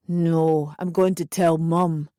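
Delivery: put-on low, stubborn drunk voice; low drunken refusal; drunken insistence. A narrator's reading. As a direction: dig in, boozy and stubborn. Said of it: "he insisted".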